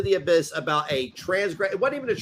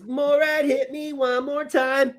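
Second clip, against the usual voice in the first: higher, slow pleading voice; mock plea; 8 semitones higher, slower than usual. Mock plea to Morad to hit again. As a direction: beg him to hit you again. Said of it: "all you gotta say is".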